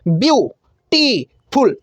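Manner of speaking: ब्यूटीफुल very slow in three clear beats; slow syllables. Shown as a demonstration. Teacher counting syllables aloud, very slowly. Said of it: "तीन बार में".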